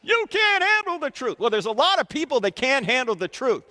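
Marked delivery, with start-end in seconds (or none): 0.0-1.4 playful movie quote. Retold or imitated. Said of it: "always wanna say that in class".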